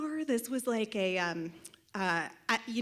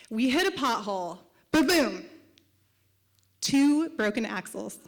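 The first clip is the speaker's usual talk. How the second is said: ordinary delivery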